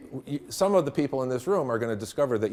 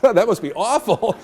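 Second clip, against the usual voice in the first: mock horror; 8 semitones higher, 8 dB louder than usual. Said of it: "And I'm thinking".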